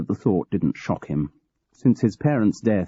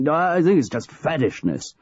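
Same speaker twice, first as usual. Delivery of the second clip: a touch of petulance, pitched higher; sulky old boss grumbling; petulant; 8 semitones higher than usual. Acted. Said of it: "George continued petulantly".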